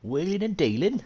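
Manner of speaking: high, put-on football manager voice; hammed-up manager catchphrase, pitched right up; Harry Redknapp impersonation. Streamer playing a manager in the transfer market, hammed-up impersonation. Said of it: "I can't do a Harry Redknapp impersonation".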